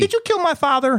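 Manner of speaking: chirpy high-pitched Minnesota greeting; Minnesota accent. Pretend. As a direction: greet a neighbour in broad Minnesotan. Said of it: "what about Minnesota".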